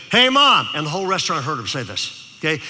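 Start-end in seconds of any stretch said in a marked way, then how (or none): 0.1-0.7 put-on loud high kid's call across the table. Loud kid calls to his mom in a restaurant, loud and high. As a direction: shout it like a loud little boy. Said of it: "And he said".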